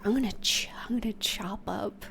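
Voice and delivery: hushed scheming whisper. Retold or imitated. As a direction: whisper it like a secret plan. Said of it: "I was like".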